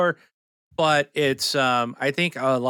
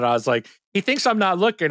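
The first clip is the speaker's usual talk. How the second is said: normal talking voice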